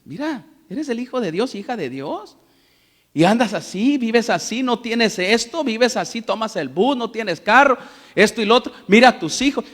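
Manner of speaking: a lightly voiced, needling tempter; mock the listener's hardships, taunting; Satanás whispering doubts. Satan taunting the believer about daily lack, lightly mocking. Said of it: "así Satanás todos los días".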